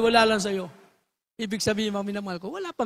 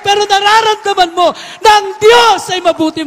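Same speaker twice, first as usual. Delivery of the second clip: loud, high preaching at full force; pulpit-pounding revival preaching; fiery preaching; 6 semitones higher, 9 dB louder than usual. Acted. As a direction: stir the congregation up to clap.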